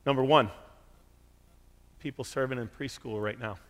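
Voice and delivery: normal talking voice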